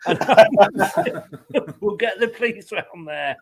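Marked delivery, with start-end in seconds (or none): 0.2-1.8 laugh. Real, not acted. Laughs at own joke.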